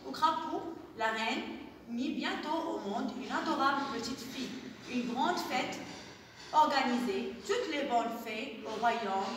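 soft read-aloud fairy tale for little ones; quiet storyteller voice; storybook reading. Acted. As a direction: read the tale gently, as if at bedtime. Teacher reads a fairy tale.

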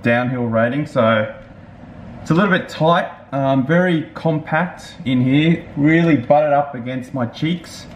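chin bar before mouth; speaking in full-face helmet. Real, not acted. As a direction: cheeks pressed by pads. Seen 0: Helmet with chin bar worn throughout; cheek pads squeeze cheeks.

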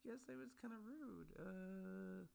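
squeaky mock "oops" voice, way up in pitch; mocking embarrassed realization. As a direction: imitate someone dawning on their own rudeness. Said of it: "going like".

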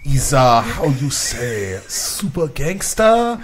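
slow, drawn-out mock French accent. Shown as a demonstration. Co-host obliging a request on a podcast, French accent. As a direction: grope for the words in a put-on French accent. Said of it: "Can you say that in a French accent?".

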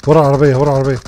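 urgent callout. Real, not acted. Callout of enemy position in fight.

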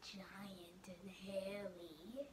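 hushed and slow, as if the monster might hear; spooky whisper. Acted. Reading a scary line to children, whispered slow. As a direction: whisper it low and slow, like a secret scare.

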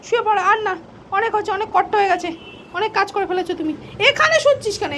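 high sing-song coaxing to a pet. Real, not acted. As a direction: coo at the pet to settle it down. (উঁচু গলায় আদুরে সুরে পোষ্যকে শুতে বলা)

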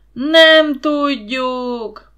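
roaring chorus reply; crowd yelling. A narrator's reading. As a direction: bellow it like a crowd. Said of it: "ordította vissza a tömeg".

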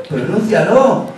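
slow, insistent dare to pronounce it; drawn-out challenge. Shown as a demonstration. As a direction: stretch the word out slowly.

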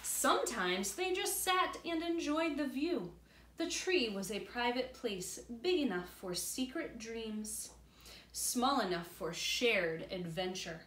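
put-on reading aloud to children; story narration. A narrator's reading. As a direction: tell it warmly to little ones.

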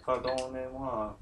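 soft, slow French phrase; French on request. Shown as a demonstration. Asked by partner to speak French, soft and slow. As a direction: offer the French politely, slowly. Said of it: "You can speak French".

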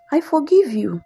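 ordinary delivery, nothing marked

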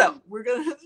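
ordinary talk

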